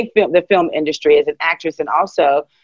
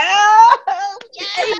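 smitten squeal. Retold or imitated. Swooning at her honey's talk.

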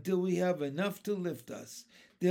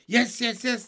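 high, loud eager yeses; 8 semitones higher, 9 dB louder than usual. Acted. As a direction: agree in a rush of eagerness. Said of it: "they said eagerly".

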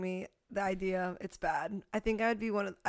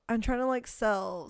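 usual speaking voice

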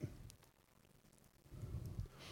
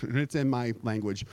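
normal talking voice

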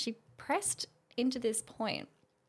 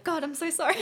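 higher and louder, giggling the apology; laughing apology; 8 semitones higher, 7 dB louder than usual. Retold or imitated. Apologizing for random thoughts popping up, with high laughter. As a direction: gush sorry through a laugh.

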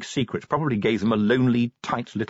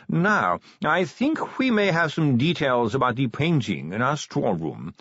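higher, hesitant curator; nervous, flustered; 7 semitones higher, slower than usual. Acted. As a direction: stall on drawn-out ums as you excuse yourself. Nervous curator excuses himself, hesitating.

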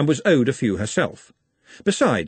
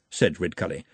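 ordinary delivery, nothing marked

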